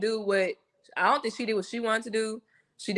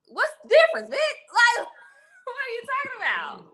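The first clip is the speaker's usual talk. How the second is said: high-pitched, incredulous comeback; mock-outraged retelling; exasperated retort. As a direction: throw it back in exasperated disbelief, breaking into a laugh. Acted exasperated retort to retold quote.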